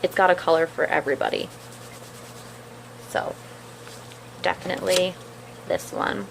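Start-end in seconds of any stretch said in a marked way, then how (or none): 3.1-6.3 talking while chewing gum; words round a wad of gum. Real, not acted. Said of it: "Sorry for my gum chewing".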